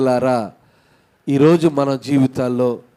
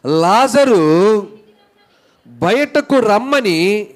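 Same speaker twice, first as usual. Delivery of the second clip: raised, higher, slow drawn-out summons; loud call; 5 semitones higher, 3 dB louder, much slower than usual. Retold or imitated. Preacher acting out Jesus calling the dead man out, loud call. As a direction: call out to someone far off, stretching the name. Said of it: "బిగ్గరగా చెప్పగా".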